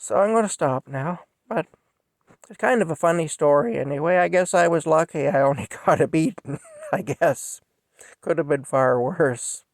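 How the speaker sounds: holding back tears with a nervous laugh; getting emotional. Real, not acted. Telling of a murdered teen, getting choked up, high and strained. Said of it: "kind of emotional talking about this".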